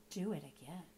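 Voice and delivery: low, hushed request; quiet, sly prompting; soft urging. Acted. Soft sly follow-up command.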